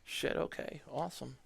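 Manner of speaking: soft, breathy relief; relieved quiet. Retold or imitated. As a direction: mutter it with relief. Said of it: "And I'm like".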